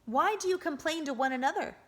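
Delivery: high, quick defiant challenge. A narrator's reading. The boy facing the hostile group, defiant and quick. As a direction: throw the question at them. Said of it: "he said defiantly".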